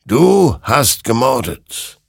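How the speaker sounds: slow, high, loud verdict; accusing. A narrator's reading. Creature to Hector, slow loud accusation. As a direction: pronounce the charge forcefully, drawn out.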